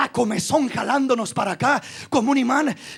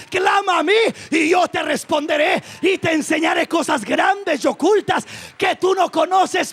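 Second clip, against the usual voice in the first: fiery preaching; 4 semitones higher than usual. Acted. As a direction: preach it with full force to the congregation. Preaching at full force.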